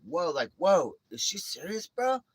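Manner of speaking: high-pitched stunned bystander; mock amazement rising in pitch; acted disbelief. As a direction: ask incredulously, as a baffled onlooker.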